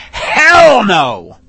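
loud, forceful denial; emphatic yelled refusal; emphatic shout. Real, not acted. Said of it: "I want to be as emphatic as I can".

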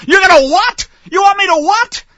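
high, loud incredulous yelp; can't believe what he's hearing; shocked disbelief. Acted. Menfolk told to be circumcised, loud disbelief.

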